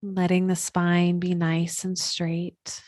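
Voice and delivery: guided meditation. Acted. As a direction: settle them into stillness, unhurried and even.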